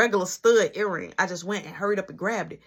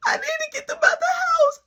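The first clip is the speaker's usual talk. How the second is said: gasp, then a high panicked cry; frantic, caught out; panicking; 3 dB louder than usual. Retold or imitated. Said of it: "I'm panicking".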